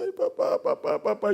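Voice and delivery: high-pitched mock sobbing into the phone; imitated crying daughter. Daughter sobbing to her father on the phone, hammed-up crying. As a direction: wail papá like a crying teenage girl. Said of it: "Yo estaba llorando".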